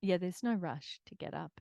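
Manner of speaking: normal talking voice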